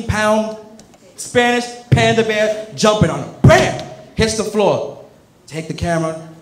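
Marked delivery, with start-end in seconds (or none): 3.4-3.8 high, loud impact shout; shouted tackle sound; shouted bam. Acted. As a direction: shout the hit like a comic book. Tackling the photographer.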